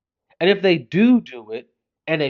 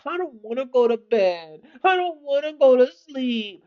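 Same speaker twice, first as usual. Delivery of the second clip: put-on whiny toddler; 7 semitones higher, 4 dB quieter than usual. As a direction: drag it out like a pouting four-year-old. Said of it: "and now".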